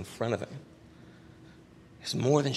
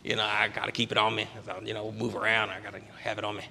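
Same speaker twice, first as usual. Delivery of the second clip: smoker's rasp; 2 semitones lower, 6 dB quieter than usual. Retold or imitated. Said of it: "He had, like, the smoker's voice".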